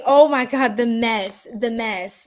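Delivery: normal talking voice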